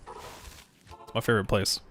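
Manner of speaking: whispered, slow deadpan sarcasm; sarcastic whisper. Real, not acted. Gamer mocking the zone he hates, whispered sarcasm. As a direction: breathe it out, dripping irony.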